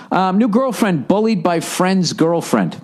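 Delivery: ordinary delivery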